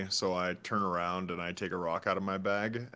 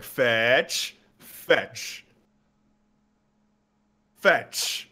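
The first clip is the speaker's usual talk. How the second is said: playful raised call, louder and drawn out; non-aggressive yell; 4 semitones higher, 7 dB louder, much slower than usual. Acted. Player keeps yelling at salamanders to fetch, not aggressively. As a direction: call it loud but friendly, word by word. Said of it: "Not aggressively. I'm like".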